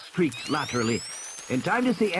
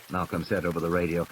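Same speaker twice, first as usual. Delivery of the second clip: ordinary delivery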